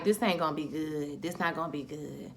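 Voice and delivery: low, hushed dread to oneself; foreboding aside. Retold or imitated. Viewer sensing trouble on screen, quietly foreboding. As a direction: drop it soft and deep, ominous.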